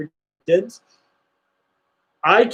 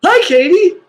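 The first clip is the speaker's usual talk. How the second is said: high and louder sing-song hello; cheery shout-out greeting; 11 semitones higher, 7 dB louder than usual. Real, not acted. Host greeting a viewer's fiancee, high cheery hello. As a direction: wave hello warmly out loud.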